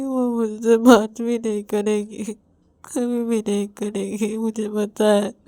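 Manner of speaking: high, tearful wailing voice; crying. Acted. Rahul weeps to Ravi about his sister, crying hard. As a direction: sob the words out, drawn out and despairing. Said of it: "Rahul रोते रोते बोलता है".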